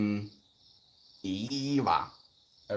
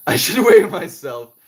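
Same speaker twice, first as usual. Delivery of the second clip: loud, high laughing joke; laughing at herself; 4 semitones higher, 8 dB louder than usual. Real, not acted. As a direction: giggle through the line.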